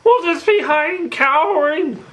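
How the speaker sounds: very high-pitched scaredy-cat cartoon voice; high, goofy cartoon impression; cowardly cartoon sidekick. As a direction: pitch it way up, a coward making excuses. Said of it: "Now we go to T V show".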